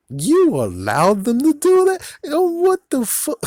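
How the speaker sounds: high, loud disbelieving shriek; incredulous outburst. Retold or imitated. Vlogger mocking the timekeepers' excuse, incredulous high shriek. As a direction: jump way up in pitch, outraged disbelief.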